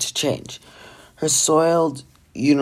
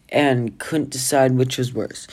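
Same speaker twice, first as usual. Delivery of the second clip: ordinary delivery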